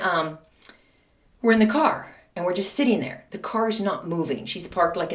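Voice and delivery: ordinary talk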